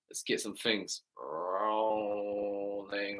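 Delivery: slow drawn-out call. Real, not acted. Hyping up live chat.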